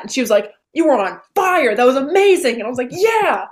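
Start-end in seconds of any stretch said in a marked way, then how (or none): 0.7-2.6 higher, louder mom praise; mom hyping. Retold or imitated. 2.9-3.5 high squealed yeah; a gleeful high yeah; answering mom with a giddy yeah; delighted yeah. Retold or imitated. Her delighted reply.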